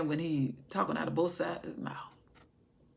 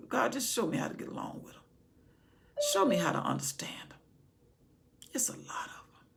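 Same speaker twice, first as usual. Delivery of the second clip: quick, then whispered prayer; pleading whisper; hushed plea; 3 semitones higher than usual. Acted.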